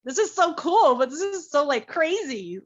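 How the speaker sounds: high, loud and thrilled, gushing over how cool it is; bursting with delight; gushing excitement. Retold or imitated. Retelling her first thrilled reaction, high excited gush.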